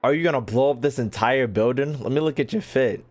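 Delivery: ordinary talk, nothing marked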